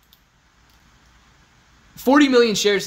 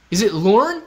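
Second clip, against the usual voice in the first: "Lorne" rising high and louder at the end; questioning pronunciation; 5 semitones higher, 7 dB louder than usual. Shown as a demonstration. Streamer asking chat how the name goes, emphatic query. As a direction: ask it, swinging up hard on "Lorne". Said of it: "how to pronounce it".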